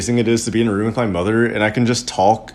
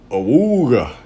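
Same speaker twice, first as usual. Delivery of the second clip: swooping "awooga" honk; cartoon horn, rising then falling; comic horn imitation; much slower than usual. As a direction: imitate an old car horn. Voiced organ's comic sound effect.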